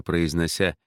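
ordinary delivery

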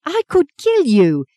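teary clenched fury; ground through teeth. Acted. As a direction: grind it out, holding back tears. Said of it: "she ground from between her teeth".

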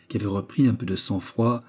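plain reading voice

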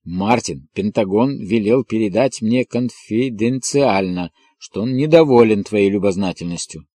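ordinary delivery

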